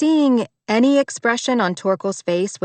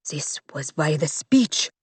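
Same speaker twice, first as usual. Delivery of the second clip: cut off; 3 semitones lower than usual. Acted. Butler starts to complain, then cuts himself off. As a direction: stop yourself before finishing. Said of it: "cut himself off".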